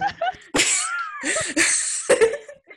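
long squealing laugh climbing high; a helpless high laugh at the teasing; high rising laugh. Real, not acted.

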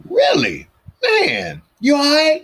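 gasp, then a high loud really; mock shocked concern. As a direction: act shocked at the news. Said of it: "And I said".

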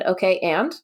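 dry, sarcastic shrug; deadpan and unimpressed. Retold or imitated. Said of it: "And I said".

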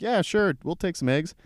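high-pitched mock enthusiasm; eager yes. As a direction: chirp it up high like an eager kid. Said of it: "We're like".

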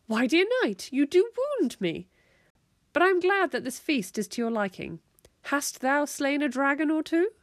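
high, hammed-up wounded dignity; grand, theatrical courtly tone; dramatic medieval lady. A narrator's reading. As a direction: mum playing along in mock-medieval style. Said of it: "clutching at her shirt dramatically".

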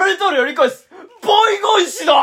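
high shouted catchphrase impression; Naoki Hanzawa. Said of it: "直樹半沢". (声を張り上げた決め台詞のモノマネ)

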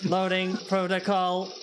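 computer voice announcing; synthetic startup voice; robot booting up. Acted. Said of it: "computer sound".

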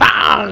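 a loud, sharp mouth sound of a heel strike; mock impact sound. Preacher acts out the old man's heel hitting the foot, loud "tang". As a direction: bang it out like a thump.

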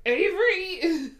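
high, loud, drawn-out name; re-enacted shout of pain; pained yell. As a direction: cry out the name as if just hurt. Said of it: "did my pain thing".

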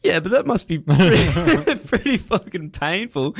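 laughing through the words, high and drawn out. Real, not acted. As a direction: break up laughing as you speak. Laughs while reacting to the story.

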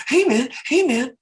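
raspy high Wahlberg greeting; scratchy Wahlberg. Retold or imitated. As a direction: scrape it out like Wahlberg. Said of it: "sounds like you're scratching".

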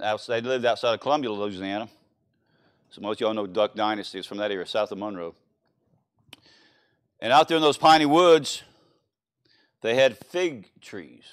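normal talking voice

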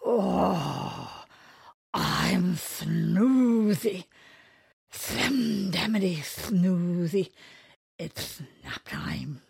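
very slow, drowsy, stretched words; sleepy voice. Acted. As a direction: drag it out like nearly asleep. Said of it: "in a very sleepy voice".

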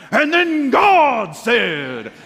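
booming, high, drawn-out preacher parody; hammed-up pulpit proclamation, very slow and high; mock preacher voice. Shown as a demonstration. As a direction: stretch it out loud like an over-the-top preacher. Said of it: "If I ever get a preacher voice".

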